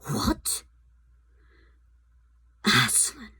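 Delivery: shocked queen; cried out. A narrator's reading. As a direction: gasp the name in alarm. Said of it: "cried the queen".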